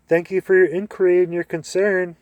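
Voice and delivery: hammed-up gracious thanks; exaggerated courtesy. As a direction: overdo the politeness to a closed door. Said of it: "with exaggerated courtesy".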